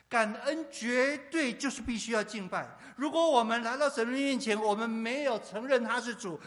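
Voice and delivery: loud, high-pitched sermon delivery; impassioned preaching. Real, not acted. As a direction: preach at full force, driving each phrase home. Preaching at full force.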